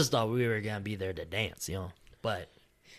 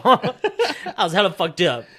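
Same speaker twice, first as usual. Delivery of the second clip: ordinary delivery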